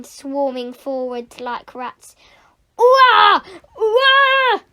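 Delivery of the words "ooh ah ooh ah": two high, loud yelps; loud falling cries of alarm; startled yelps. Acted. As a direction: yelp as if under attack. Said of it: "yelped Nick".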